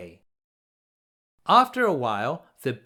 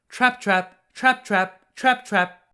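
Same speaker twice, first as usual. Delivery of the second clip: higher, louder rhythmic trap, trap; a mid-sized goat's steady steps; trapping hoof sounds; 5 semitones higher, 3 dB louder than usual. A narrator's reading. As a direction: tap out the hooves in rhythm. Middle goat's hooves.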